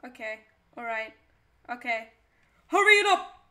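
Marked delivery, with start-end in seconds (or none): 2.7-3.4 impatient customer. Acted.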